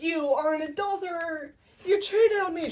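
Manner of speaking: high, wailing mock outrage; mock accusation. Othello accusing Desdemona of cheating, mock outrage. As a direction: accuse in hammed-up hurt.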